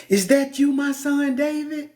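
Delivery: sugar-coat it, you don't mean it; fake sweet talk. Saul calling out to David, high fake sweetness. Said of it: "That fake talk".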